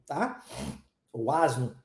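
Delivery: ordinary talk, nothing marked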